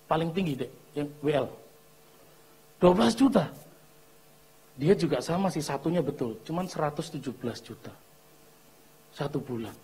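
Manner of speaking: ordinary delivery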